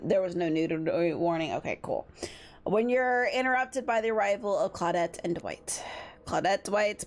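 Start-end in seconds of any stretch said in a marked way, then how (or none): none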